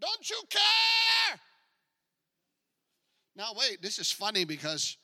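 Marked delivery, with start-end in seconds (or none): 0.0-1.4 high, drawn-out cry of the panicked disciples; acted high-pitched yell; screaming out. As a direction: scream it at someone sleeping, stretching the last word. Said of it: "they scream out, they say".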